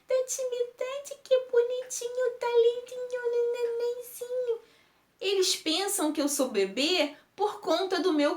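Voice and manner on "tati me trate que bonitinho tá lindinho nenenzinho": much higher, sing-song baby talk voice, imitating adults cooing; mock the grown-ups cooing at a baby. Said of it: "me tratam como se eu fosse um bebê". (imitando voz de falar com bebê, bem aguda e melosa)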